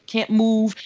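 usual speaking voice

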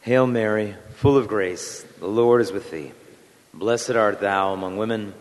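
low, measured, reverent prayer voice. Acted. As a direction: lead the congregation in prayer, unhurried. Opening prayer led aloud.

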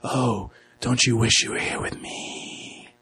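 slow, soft come-hither voice; seductive singer. Retold or imitated. Podcaster mimicking the fantasy singer, slow and seductive. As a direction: draw it out softly, imitating a seductress. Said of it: "she sings and she's all like".

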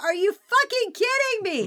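high incredulous outburst; exasperated. Retold or imitated. Quatermass to the generals, mock disbelief. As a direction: throw up your hands in disbelief.

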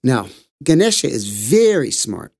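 plain reading voice

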